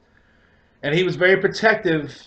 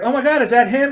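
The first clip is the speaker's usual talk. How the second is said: high, louder excited gasp; excited girlfriend; 10 semitones higher, 6 dB louder than usual. Retold or imitated. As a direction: she spots the dog and gets thrilled. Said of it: "she's like".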